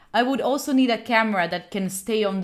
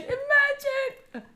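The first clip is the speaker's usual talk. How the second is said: mock dreamy; 13 semitones higher, 4 dB quieter, much slower than usual. Dreamy joke about mannequin.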